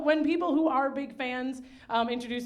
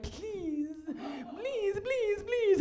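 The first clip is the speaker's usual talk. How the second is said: mock begging; 9 semitones higher, 3 dB quieter, much slower than usual. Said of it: "some jobs where you're like".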